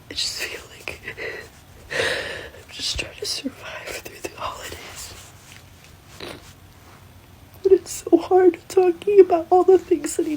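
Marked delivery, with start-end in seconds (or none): 0.1-5.1 slow tearful whisper. Real, not acted. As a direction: whisper through tears, slowly. Crying woman whispering.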